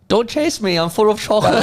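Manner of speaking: high, laughing cartoon German boy; squeaky cartoon kid impression; Simpsons character voice. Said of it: "the Simpsons character?".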